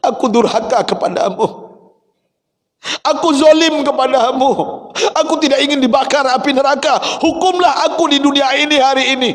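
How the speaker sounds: high, pleading, tearful cry; high anguished wife pleading; Aisyah weeping. Acted. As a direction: beg for punishment through tears. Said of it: "Aisyah menangis".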